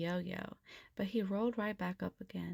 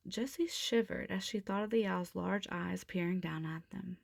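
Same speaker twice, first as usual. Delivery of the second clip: ordinary delivery, nothing marked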